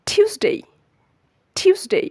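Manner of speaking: "টিউসডে" as high "TYOOS-dey", falling; correct pronunciation. Shown as a demonstration. Teacher modelling for learners, emphatic correct pronunciation. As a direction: punch an aspirated "tyoos" high, then glide "dey" in "টিউসডে". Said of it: "সঠিক এবং শুদ্ধ উচ্চারণ হচ্ছে".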